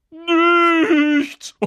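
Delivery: very high, loud, stretched-out squeal; Peeves, gleeful taunt. A narrator's reading. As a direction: crow it triumphantly, dragging the vowel. Peeves gleefully tricks Filch.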